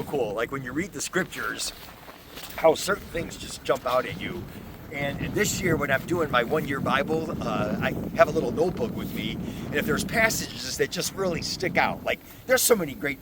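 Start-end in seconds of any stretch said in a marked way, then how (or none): none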